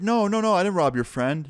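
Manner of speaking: mock-frightened, pitch jumping up then easing; imitated scared denial. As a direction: deny it like a cornered robber, lightly acted. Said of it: "he was kinda scared in the face".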